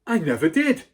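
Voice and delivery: loud, high protest; exclaimed denial. A narrator's reading. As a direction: blurt out the denial. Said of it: "the boy exclaimed".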